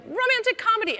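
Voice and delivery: high-pitched mock shout; imitated audience suggestion; audience call-out. Audience calls out a genre.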